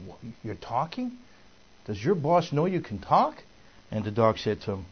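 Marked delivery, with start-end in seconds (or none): 0.1-3.4 incredulous questions, pitch leaping up at the end; disbelieving, with high rising question endings; astonished questioning. Retold or imitated. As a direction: act out a man stunned by what he hears. Acts astonished man questioning a dog.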